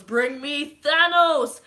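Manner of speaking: loud, slow commanding roar; Thor's battle cry. Retold or imitated. Thor calling for Thanos, slow roaring battle cry. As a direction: bellow it like a god of thunder. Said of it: "Thor goes".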